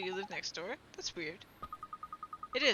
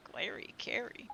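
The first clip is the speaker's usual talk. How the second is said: breathy whispered repeat of Glary Carry; 7 dB quieter, slower than usual. Acted. Fairy repeating a nickname to herself, whispered.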